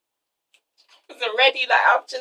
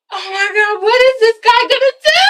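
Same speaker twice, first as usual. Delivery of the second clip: gasp, then high rising disbelief; acted overwhelmed, pitch climbing to the end; gasping amazement; 9 semitones higher than usual. Girlfriend seeing him at the tunnel's end, gasping amazement.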